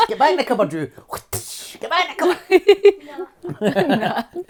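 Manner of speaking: high, laughing mock telling-off; playful scolding. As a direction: shoo the child off, half laughing.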